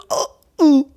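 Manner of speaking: high-pitched mock groan; comic high "ooh" of someone hit by a dart; imitated dazed reaction. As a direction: act out being knocked woozy. Hit by tranquilizer dart, passes out.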